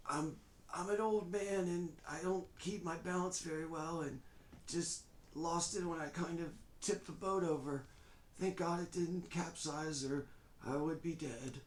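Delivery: put-on high, halting frail old man. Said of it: "this kind of frail voice".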